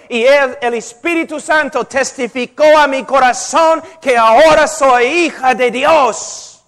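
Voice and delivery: fiery sermon climax; full-force preaching. Acted. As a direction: hammer the truth home from the pulpit.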